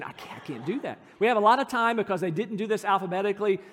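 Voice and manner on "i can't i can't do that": low, soft voiced stage whisper; retold whisper. Husband refusing his wife during the ceremony, mock whisper. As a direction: imitate a hushed, repeated refusal. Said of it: "having this little whisper discussion".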